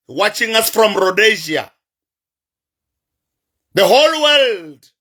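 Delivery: normal talking voice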